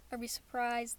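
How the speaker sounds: usual speaking voice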